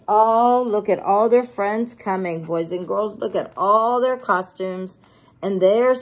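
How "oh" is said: ordinary delivery, nothing marked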